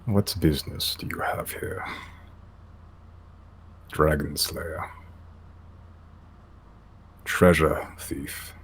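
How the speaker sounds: hushed, slow, guttural elder yeti. Acted.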